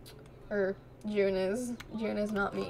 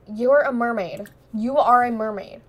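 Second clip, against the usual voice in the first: loud accusation; 7 dB louder than usual. Pretend. Voicing Sim accusing mermaid.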